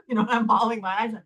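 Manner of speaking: normal talking voice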